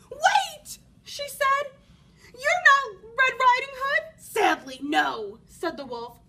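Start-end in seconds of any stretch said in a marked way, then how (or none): 0.1-4.2 high loud startled granny; alarmed grandmother. Acted. 4.2-5.4 sly wolf. Acted. Wolf replies.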